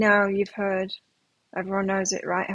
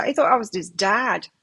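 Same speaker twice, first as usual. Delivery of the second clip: usual speaking voice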